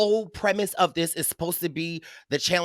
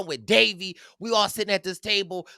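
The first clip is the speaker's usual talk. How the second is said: ordinary talk, nothing marked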